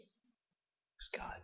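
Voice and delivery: breathy whispered aside of false modesty. Retold or imitated. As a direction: drop to a whisper, pretending modesty.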